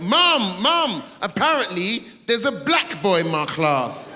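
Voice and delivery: put-on childish excited shout to mum. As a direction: burst in like a thrilled little boy. Kid running home to tell mum.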